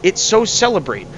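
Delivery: ordinary talk, nothing marked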